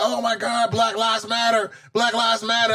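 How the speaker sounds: high, mock-outraged internet critics. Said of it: "Everybody started criticizing".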